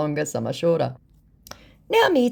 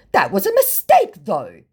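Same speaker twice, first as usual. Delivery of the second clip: much higher, louder put-on protest; hammed-up indignant scammer; mock indignant scammer; 5 semitones higher, 6 dB louder than usual. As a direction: squeak it out indignantly. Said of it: "indignant now".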